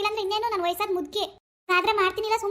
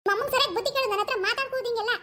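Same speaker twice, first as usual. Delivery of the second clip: put-on a higher, nagging young wife; daughter-in-law role; 7 semitones higher than usual. Actor voices daughter-in-law scolding husband about mother. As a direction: complain sharply to husband about his mother.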